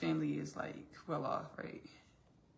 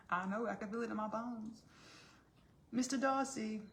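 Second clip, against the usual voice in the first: high, mock-romantic heroine; hammed-up costume-drama declaration; Elizabeth; 6 semitones higher than usual. Elizabeth answers Darcy in the love scene, mock romantic.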